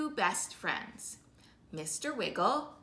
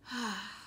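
sigh; 7 dB quieter than usual. Acted. No one answered, gives up.

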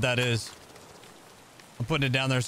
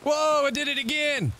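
high startled whoa; surprised shout; 13 semitones higher than usual. Real, not acted. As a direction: yelp out in surprise. Sudden game mishap.

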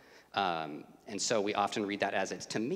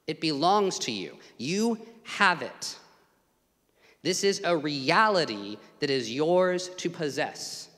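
louder, emphatic sermon lines; rising preaching; 4 semitones higher, 4 dB louder than usual. Acted. Preacher urges believers the mind of Christ is theirs, building. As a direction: build it up with conviction.

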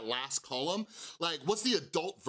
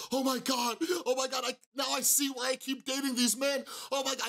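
high, panicked "oh my God" burst, much higher pitch; freaking out; 8 semitones higher than usual. Retold or imitated. Young woman in treatment realizing her pattern, panicked high outburst. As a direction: reenact the young woman's rush of realization, voice shooting up. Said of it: "she's, like, freaking out".

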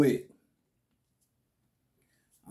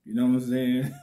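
usual speaking voice

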